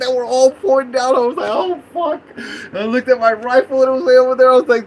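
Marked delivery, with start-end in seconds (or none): 1.5-2.2 high, dismayed curse; high-pitched acted alarm; retold panic. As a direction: act out the sinking realization, pitched way up. Retelling realizing he is trapped.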